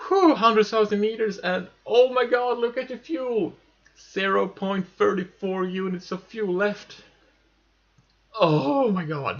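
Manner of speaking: high, loud, amazed relief; giddy disbelief at tiny fuel; breathless relief. Real, not acted. Orbit reached, no fuel left.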